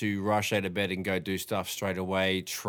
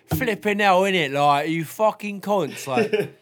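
put-on high-pitched nasally British accent; squeaky nasal British mock voice; 11 semitones higher, 9 dB louder, slower than usual. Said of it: "a high-pitched nasally voice".

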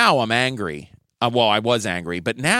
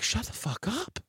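high, much softer hissed retort; retold hushed snap; 8 semitones higher, 11 dB quieter than usual. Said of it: "I was just like".

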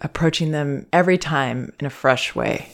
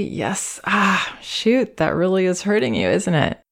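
higher, feeling the hurt with him; empathizing with baby; 4 semitones higher than usual. Shown as a demonstration. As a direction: acknowledge the pain, really seeing him. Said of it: "to really see".

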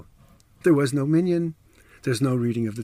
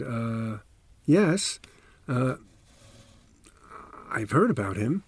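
hesitant; slower than usual. Retold or imitated. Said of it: "He said very hesitantly".

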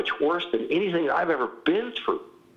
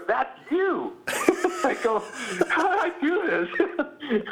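laughing retelling; 10 semitones higher, slower than usual. Retold or imitated. Laughing as he retells movie moment.

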